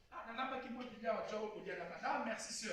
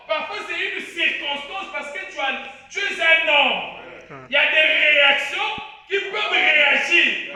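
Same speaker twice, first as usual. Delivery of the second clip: preaching at full force; 4 semitones higher, 12 dB louder than usual. Acted. Preacher warning the church about men's reactions, building to a full shout. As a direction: drive it home to the congregation, building louder.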